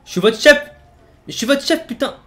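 high, loud furious shout; angry yell. Real, not acted. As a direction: bark it to put him in his place. Asserting authority over teammate.

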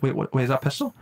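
ordinary talk, nothing marked

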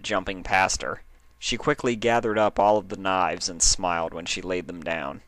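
ordinary delivery, nothing marked

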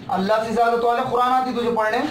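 ordinary talk, nothing marked

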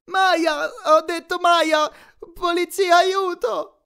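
very high, loud cries for help; frantic high-pitched screaming for rescue; panicked yelling. A narrator's reading. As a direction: shout in mounting desperation, stretching the words. Grover dragged off, yelling for help.

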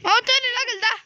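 excited gamer shout in the heat of the fight. Real, not acted. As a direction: cheer your friend on mid-fight. Excited call during fight.

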